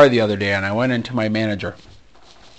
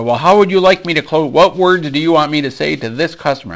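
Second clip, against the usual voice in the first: louder, higher exasperated questioning; raised voice; 5 semitones higher, 5 dB louder than usual. Retold or imitated. Salesman pushing back at his manager, a little bit louder. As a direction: press the question, voice rising over the manager's. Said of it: "start talking a little bit louder".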